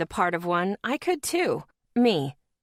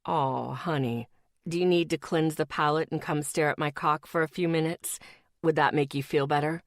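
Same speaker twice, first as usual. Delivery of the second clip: mock-sweet boyfriend; 4 semitones lower than usual. Boyfriend teasing his girlfriend, mock-sweet and low. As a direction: coo at her in mock sympathy.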